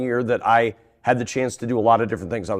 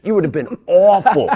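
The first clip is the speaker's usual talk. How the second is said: laughing; 7 semitones higher, 6 dB louder than usual. Retold or imitated. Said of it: "he goes".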